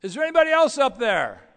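high, loud call upward; hopeful shout. Retold or imitated. Man on the branch calling out for someone else, loud and high. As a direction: shout up, hoping for a different answer. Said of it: "he says".

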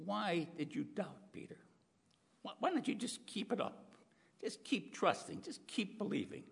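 Jesus asking Peter softly and sadly why he doubted; pained disappointment. Acted. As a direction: ask gently, full of disappointment. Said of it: "you can almost feel that in Jesus' voice".

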